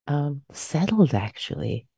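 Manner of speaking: ordinary talk, nothing marked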